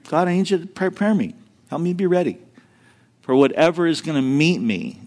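soft, hushed earnest prayer; whisper-close plea to God; quiet prayer. Retold or imitated. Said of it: "I began to pray that".

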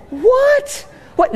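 high, laughing What? in disbelief; a squeaky startled what, laughing; shocked laugh. Retold or imitated. Dad laughing at his daughter's joke, a high shocked what.

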